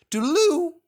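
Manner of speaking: bright toodaloo; cheery farewell. Acted. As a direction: call it out as you leave. Thomas's farewell call.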